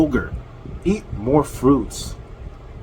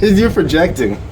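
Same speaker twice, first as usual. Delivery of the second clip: amused comeback, pitched up through laughter; laughing retort; 4 semitones higher, 4 dB louder than usual. Real, not acted. Reactor answers the insult about his voice, laughing. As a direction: laugh as you fire it back.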